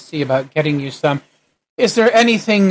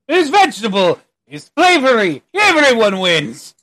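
vendor voice; 3 semitones higher than usual. Acted.

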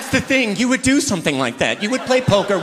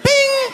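high chime-like bing; ping it like a bell; mock bell; 4 dB louder than usual.

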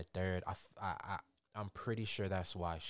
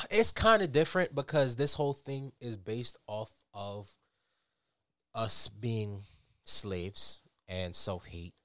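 usual speaking voice

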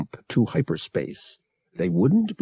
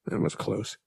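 muttered; 8 dB quieter than usual. A narrator's reading. Pilot after a near hit, muttering. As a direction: mutter it softly to yourself. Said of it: "Han muttered".

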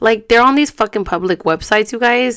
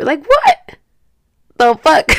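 incredulous outburst; 9 semitones higher, 7 dB louder, slower than usual. Real, not acted. Reacting to the inmate's carefree profile, incredulous and loud. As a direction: pitch jumps high on what, then a loud the fuck. Outraged reaction after mocking quote.

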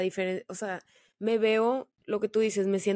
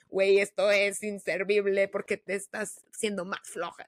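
inner critic voice. Retold or imitated. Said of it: "mi mente era de que".

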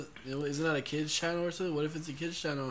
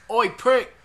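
loud, high mock British yell; best British accent; 8 semitones higher, 12 dB louder than usual. Shown as a demonstration. Host demonstrating his accent to the co-host, British accent. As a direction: bark it in a hammed-up British accent. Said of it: "my best British accent".

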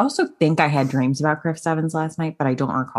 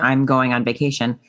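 ordinary talk, nothing marked

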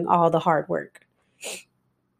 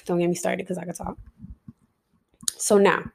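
normal talking voice

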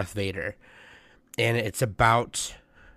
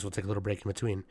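ordinary talk

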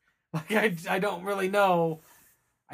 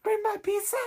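much higher, hammed-up fake Spanish accent; overdone fake Spanish accent, high and breathless-sounding; mock Spanish woman greeting the pizza boy, high fake accent; bad Spanish accent; 13 semitones higher, 3 dB quieter than usual. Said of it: "It was awful".

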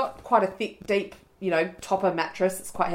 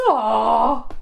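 teary aw; 3 semitones higher, 4 dB louder than usual. Retold or imitated. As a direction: melt a little. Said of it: "I was like".

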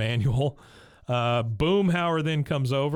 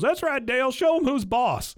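much higher, cheering Bill voice; 11 semitones higher than usual. Retold or imitated. Bill cheering Dale on from below, much higher voice. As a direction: egg Dale on from below, high.

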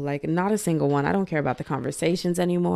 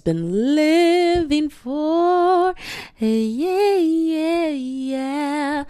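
gushing; 9 semitones higher, 4 dB louder, much slower than usual. Real, not acted. Gushing about a favorite show.

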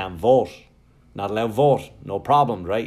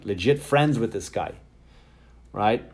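usual speaking voice

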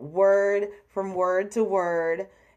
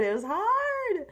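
high-pitched whine; 11 semitones higher than usual. Real, not acted. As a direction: repeat it in a high, stretched-out squeal of complaint. Repeats it to excuse her attempt.

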